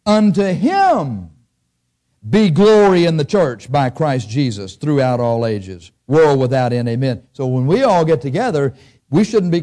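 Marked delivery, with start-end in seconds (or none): 0.1-7.2 slow, weighty reading from the Bible; measured pulpit recitation; scripture reading. Acted. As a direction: read it out with solemn weight. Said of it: "I'll read it to you".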